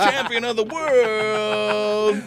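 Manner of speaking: slow, drawn-out, booming ring announcer; grand entrance announcement. Acted. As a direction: stretch every word like a title fight.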